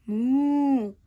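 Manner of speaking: loud long moo; loud cow lowing. Acted. Animals chase dog.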